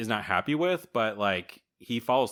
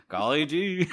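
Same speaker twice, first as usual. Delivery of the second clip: higher, playful hammed-up "golly gee"; mock golly gee; 7 semitones higher than usual. Repeating Byam's "golly gee" in a higher, hammed-up voice. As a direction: tease it like a wide-eyed boy.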